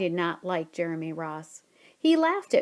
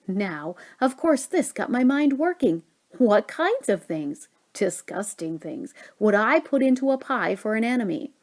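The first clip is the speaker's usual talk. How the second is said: ordinary delivery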